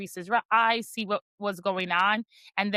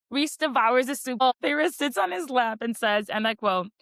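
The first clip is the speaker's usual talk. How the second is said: ordinary talk, nothing marked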